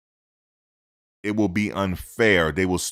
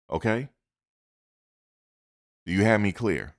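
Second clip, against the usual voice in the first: louder, insistent scolding; heated lecture; 2 semitones higher, 3 dB louder than usual. Real, not acted. Host scolding critics who lump coaches together. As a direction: press the point hard.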